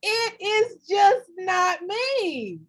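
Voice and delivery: slow, loud laughing protest; laughing outburst. Real, not acted. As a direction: burst out laughing at herself. Laughing outburst about herself.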